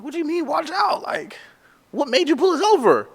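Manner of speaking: high-pitched, baffled voice; acting out his own shock, voice jumped up high; retold confused disbelief. Pulled over by police, asking why in high disbelief. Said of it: "And I'm like".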